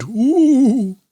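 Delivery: high, loud hooting owl; owl shouting. Acted. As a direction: hoot it out loud and high to scare. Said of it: "flapped his wings and shouted".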